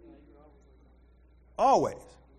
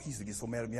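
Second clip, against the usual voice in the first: ordinary talk, nothing marked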